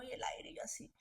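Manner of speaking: a soft unvoiced whisper trailing off. Real, not acted. Recalling the cold air of a childhood vision, whispered. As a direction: breathe it out as a hushed aside.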